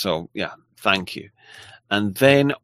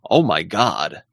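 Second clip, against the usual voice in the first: badly attempted American accent, a bit lower; terrible American accent; 4 semitones lower, 3 dB louder, slower than usual. Shown as a demonstration. Host obliging a viewer's request, terrible American accent. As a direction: have a clumsy go at American. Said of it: "I do a terrible American accent".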